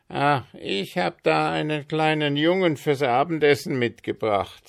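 put-on a storybook child-eater, fussing then calling out; Mister Marker calling his wife. A narrator's reading.

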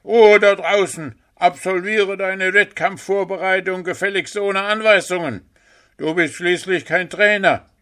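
high, loud furious yell out the window; angry shout. A narrator's reading. Puh yells at Willi from his window, furious shout. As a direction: bellow at the noisy neighbour to shut up.

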